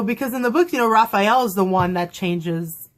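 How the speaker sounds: usual speaking voice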